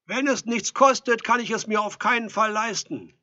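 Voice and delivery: high, loud refusal; shouting back. A narrator's reading. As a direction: shout it back through the door. Edelstein shouts back at door.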